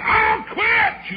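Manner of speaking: high mimicked cry; mock quitter. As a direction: cry it out high like a preacher giving up. Mimics preachers saying they quit.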